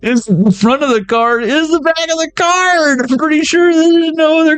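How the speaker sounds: high, excited squeal; rising into a high squeal. Real, not acted.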